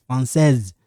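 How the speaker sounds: "Française" ending in a clearly voiced z; sounded final Z. Shown as a demonstration. Teacher showing the feminine ending, voiced final consonant. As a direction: let the last s of "Française" buzz as z. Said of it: "It sound like this".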